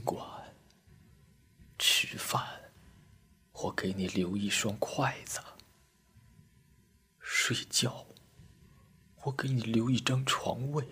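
very soft, near-whispered grieving mumble; hushed private lament; murmuring to himself. A narrator's reading. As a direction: murmur to a dead friend, pausing long between phrases. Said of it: "嘴里喃喃自语道".

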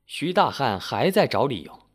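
ordinary delivery, nothing marked

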